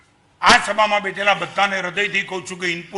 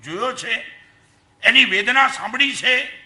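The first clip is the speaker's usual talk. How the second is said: ordinary delivery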